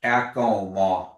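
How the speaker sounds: Kak On Ma, each syllable slow and apart; staccato. Shown as a demonstration. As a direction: sound out Kak On Ma slowly. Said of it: "one word at a time".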